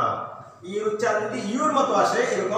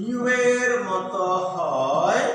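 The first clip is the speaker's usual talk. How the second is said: slow emphasis; 2 semitones lower, much slower than usual. Shown as a demonstration. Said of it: "teacher slows for emphasis".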